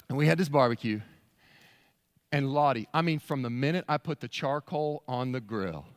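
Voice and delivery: normal talking voice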